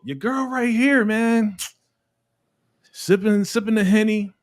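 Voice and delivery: playful. Real, not acted. Describing the girl sipping Henny, high and teasing.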